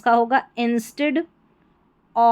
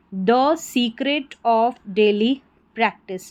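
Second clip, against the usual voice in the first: very slow teaching read-out; measured model reading for learners; word by word; much slower than usual. Shown as a demonstration. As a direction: read slowly, pausing between words. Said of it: "तोड़ के pronounce करना, पढ़ना है टुकड़ों में".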